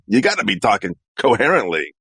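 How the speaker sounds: ordinary talk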